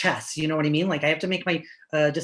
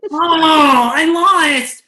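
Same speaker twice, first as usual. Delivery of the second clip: much higher, much louder cry of defeat; anguished wail; 10 semitones higher, 14 dB louder, much slower than usual. Acted. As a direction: wail at losing the game. Gamer loses the game.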